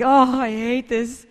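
slow, high, loud mock groan; acted exasperation. Daughter leaving amends with dad, hammed-up exasperation. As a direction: drag it out in exaggerated misery.

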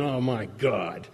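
slow, low, drawn-out exasperation; exasperated. Retold or imitated. Exasperated at back of meeting.

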